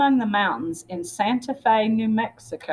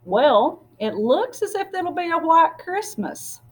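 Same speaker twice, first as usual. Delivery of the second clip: cheerful townsman; 9 semitones higher than usual. Acted. Man in street remarks.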